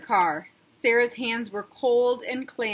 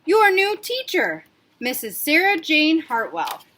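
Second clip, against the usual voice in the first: proud announcement; 6 semitones higher, 6 dB louder than usual. Acted. Principal introducing the new teacher to the class, proudly. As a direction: present her with a flourish.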